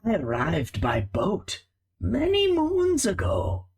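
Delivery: lightly voiced wise old rat, slow and solemn; old rat storyteller. GM voicing the old rat telling Sage his past, solemn. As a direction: tell it like an ancient sailor rat.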